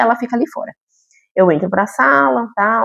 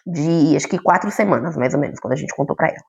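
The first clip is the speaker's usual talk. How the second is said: ordinary delivery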